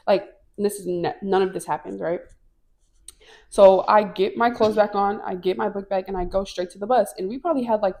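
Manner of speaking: normal talking voice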